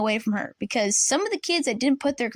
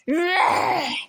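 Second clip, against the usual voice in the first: disgusted groan; 6 semitones higher than usual. Retold or imitated. Glaring back at the teacher.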